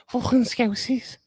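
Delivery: high, badly faked Scouse; amateur Liverpool accent in a high voice; terrible Liverpool accent. Shown as a demonstration. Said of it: "No, can't do that. That was terrible".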